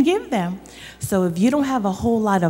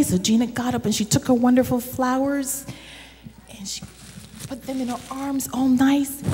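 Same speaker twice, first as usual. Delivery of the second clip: ordinary delivery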